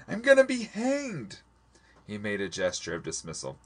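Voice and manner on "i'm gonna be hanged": very high, slow, desperate outburst; high-pitched wail of protest; bursting out. A narrator's reading. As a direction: burst out in despair, pitch soaring. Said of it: "I burst out".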